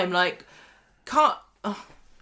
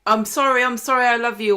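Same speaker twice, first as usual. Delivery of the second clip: mock Roy pleading; 3 semitones higher, 5 dB louder than usual. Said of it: "or whatever he was saying".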